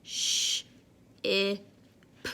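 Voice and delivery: sh, i, p said sound by sound; sounding out words. Shown as a demonstration. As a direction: segment each sound slowly. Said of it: "Let's say the sounds together".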